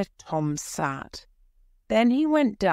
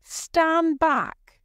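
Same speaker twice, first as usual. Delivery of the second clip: high, loud soldier's command; soldier's order; 12 semitones higher, 3 dB louder than usual. A narrator's reading. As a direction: bark him back sharply. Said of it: "said one of the soldiers".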